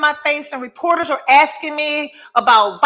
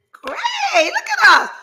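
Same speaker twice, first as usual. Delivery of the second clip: much higher, shouted delight; excited exclaim; slower than usual. Real, not acted. Cheering herself and viewers on time, excited shout. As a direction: squeal it with glee.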